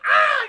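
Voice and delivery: very high, loud yelp; mock scream. Streamer acting out the stabbed hand, loud mock scream. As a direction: shriek in imitated sudden pain.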